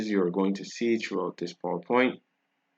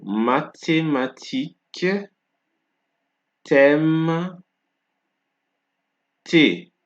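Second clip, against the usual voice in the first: mathématique, thème, thé said slowly, TH as plain T; careful classroom pace; slow and clear; 7 semitones higher, 4 dB louder, slower than usual. Shown as a demonstration. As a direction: spell out each word slowly for learners. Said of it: "let me say it slowly".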